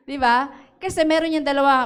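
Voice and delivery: ordinary talk, nothing marked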